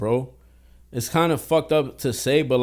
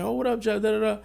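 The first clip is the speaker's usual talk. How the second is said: much higher mock cousin voice, casual; cousin's greeting; 10 semitones higher than usual. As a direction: put on a high, breezy cousin greeting. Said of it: "He's like".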